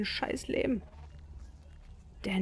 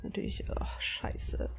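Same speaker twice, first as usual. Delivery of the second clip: whispering; 7 dB quieter, slower than usual. Real, not acted. Tense sneaking in game.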